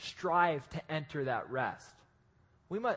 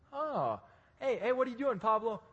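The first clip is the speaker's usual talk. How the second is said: lightly voiced eager friend, pitched up; high greeting voice; 5 semitones higher than usual. Eric calling out to Pablo on the island, high and eager. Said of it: "He'd be like".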